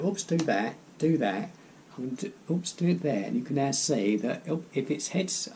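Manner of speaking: ordinary delivery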